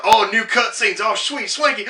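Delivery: high, eager mock hype; excited. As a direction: gush like a hyped fan. Game voiced as excited.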